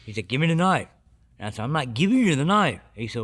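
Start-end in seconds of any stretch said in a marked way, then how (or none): 0.3-0.9 raised commanding voice; officer demanding. Retold or imitated. Deputy ordering him to hand over the knife, raised. 1.6-2.8 raised, defiant voice; defiant refusal. Retold or imitated. Refusing the deputy who wants his knife, raised defiance.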